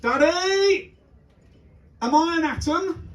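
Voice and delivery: put-on high-pitched child calling Daddy; imitating a child, high and insistent; young son. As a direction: pipe up like a kid calling from another room. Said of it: "from the other bedroom".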